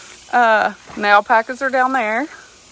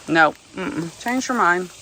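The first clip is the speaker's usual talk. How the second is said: usual speaking voice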